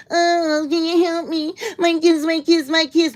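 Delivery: very high whiny mock of a woman begging; high, whining parody of her tears; mock crying plea.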